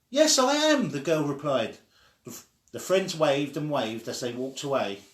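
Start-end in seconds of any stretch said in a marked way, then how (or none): 0.1-0.9 high, loud call across the street; child calling to her friend across the street. Acted. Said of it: "They had to use loud voices".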